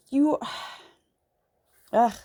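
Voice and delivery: ordinary delivery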